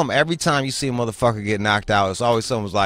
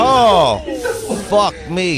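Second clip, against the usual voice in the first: shocked high cry; appalled, higher and louder; horrified amusement; 10 semitones higher, 5 dB louder, much slower than usual. Real, not acted. Watching kid knocked out.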